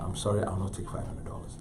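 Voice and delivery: soft, composed, imitated dealer; quiet refusal. Gallery dealer turning down the senator, quietly firm. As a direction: decline politely in a low-key voice. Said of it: "I saw Leroy speaking his heart".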